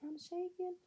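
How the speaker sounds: very high-pitched mock questioning; imitated bewildered wife. Wife answering the host about her shaking, imitated high bewilderment. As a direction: pitch it way up, as if only just noticing. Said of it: "She's like".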